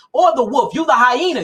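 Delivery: ordinary delivery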